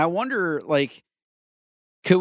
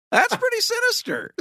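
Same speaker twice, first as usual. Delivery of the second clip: excited. Real, not acted. Excited commentary.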